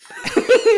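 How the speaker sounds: helpless peal of laughter; high, loud laugh. Real, not acted. Cracking up at a friend's blunt reply, high loud laugh. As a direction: burst out in high-pitched laughter.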